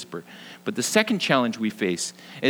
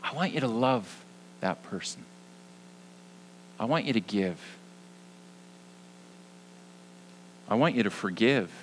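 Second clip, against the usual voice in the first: soft and tender, much quieter; God's soft whisper; 7 dB quieter than usual. Pretend. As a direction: hush it like a whisper. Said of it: "God whispers to us and he says".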